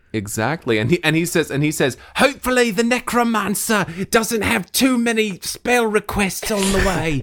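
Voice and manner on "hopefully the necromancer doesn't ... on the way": high-pitched character voice; little guard's voice. Acted. Little guard hoping the spell goes through, high-pitched. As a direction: pitch it well above your own voice.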